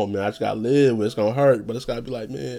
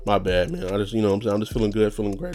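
ordinary talk

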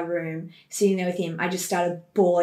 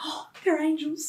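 adoring; 9 semitones higher, 5 dB quieter than usual. Retold or imitated. Waking to sleeping baby, adoring.

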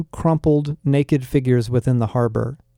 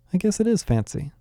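soft murmur to himself, higher; quietly to himself; 6 semitones higher, 5 dB quieter than usual. A narrator's reading. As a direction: say it softly, just for himself. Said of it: "saying quietly to himself".

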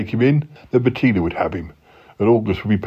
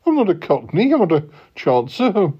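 indignant denial; 7 semitones higher than usual. Acted.

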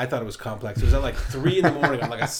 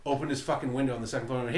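usual speaking voice

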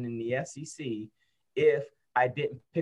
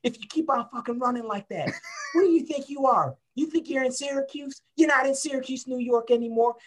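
mock tirade of an old football coach; imitating coach yelling; 12 semitones higher than usual. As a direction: chew him out like a riled coach. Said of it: "growling at you".

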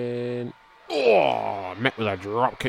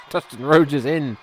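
loud call; 4 semitones higher, 9 dB louder than usual. Real, not acted. Calling out the wrestler's entrance.

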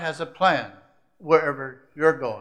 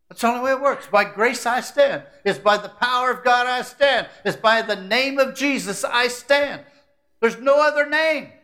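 full-force preaching; 9 semitones higher, 7 dB louder than usual. Acted. Preacher proclaiming to the church, at full force. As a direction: proclaim it to the back row.

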